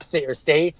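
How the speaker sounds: ordinary talk, nothing marked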